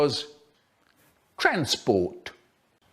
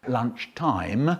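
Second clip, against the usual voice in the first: ordinary delivery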